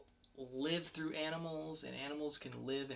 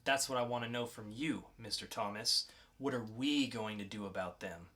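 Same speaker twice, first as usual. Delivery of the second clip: lightly voiced calm interrogator, cool and in control; 6 semitones higher, 5 dB louder than usual. Podcast host reads the mysterious agent to a captured teen, cool and controlled.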